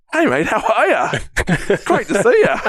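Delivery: high, eager hello; excited greeting. Retold or imitated. Second bloke greeting him, high and eager. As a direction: greet him like an old friend. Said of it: "rocks up to me and goes".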